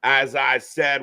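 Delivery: ordinary talk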